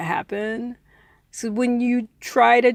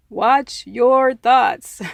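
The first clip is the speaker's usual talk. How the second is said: slow and louder, each word weighted; emphatic advice; 3 semitones higher, 4 dB louder, much slower than usual. Real, not acted. Teacher stressing her usual advice to listeners, slow and emphatic. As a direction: lean on every word, slowly.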